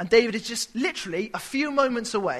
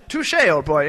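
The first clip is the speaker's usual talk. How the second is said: posh gent; 5 semitones lower, 5 dB louder, slower than usual. Shown as a demonstration. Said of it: "it's not really the kind of".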